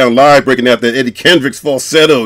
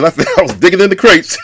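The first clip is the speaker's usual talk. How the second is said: laughing; 6 semitones higher than usual. Real, not acted. Laughing while admitting digging.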